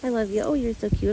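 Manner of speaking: mock doting coo; lightly put-on baby voice; a hint of gushing pet talk; imitating baby talk. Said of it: "baby him and be like".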